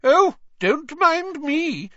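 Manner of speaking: high, loud, drawn-out indignation; hammed-up huffy fat lady; irritable calling. A narrator's reading. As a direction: call after them in slow, high sarcasm. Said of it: "called irritably after them".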